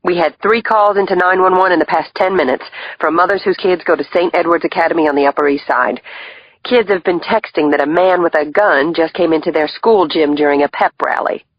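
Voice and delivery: tense, rapid briefing voice; brief it urgently, high; urgent female agent. Acted.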